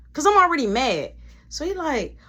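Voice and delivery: ordinary delivery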